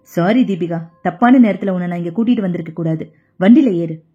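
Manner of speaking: low, quick apology from a rattled son; shaken young man. Acted. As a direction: hurry her back into the car, voice dropped low. (தாழ்ந்த குரலில் பதறிய மகனின் அவசர மன்னிப்பு)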